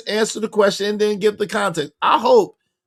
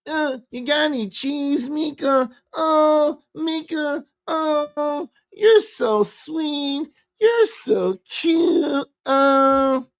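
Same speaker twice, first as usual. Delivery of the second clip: higher, very slow mock nerd cooing; Urkel-style sweet-talk; 8 semitones higher, slower than usual. Host keeps mocking the guest's quote as Urkel, high and slow. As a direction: drag it out like a lovesick nerd. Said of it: "He ain't Urkel".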